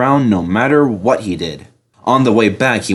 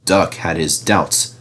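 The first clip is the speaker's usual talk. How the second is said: usual speaking voice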